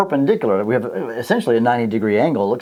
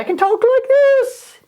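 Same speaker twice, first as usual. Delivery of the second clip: very high squeaky voice, a bit louder; high voice demo; 4 dB louder, slower than usual. Shown as a demonstration. Teacher demonstrating frequency to class, very high squeaky voice. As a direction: jump way up into a squeak to show one frequency. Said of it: "And that's one frequency".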